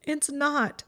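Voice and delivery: high, upset retort; sharp. A narrator's reading. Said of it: "she said sharply".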